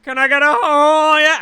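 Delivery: high, loud mock drag queen plea; mock squeaky plea. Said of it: "it's just like".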